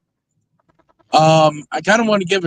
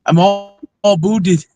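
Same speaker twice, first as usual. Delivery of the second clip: mock Canadian accent; 3 dB louder than usual. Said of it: "my Canadian accent".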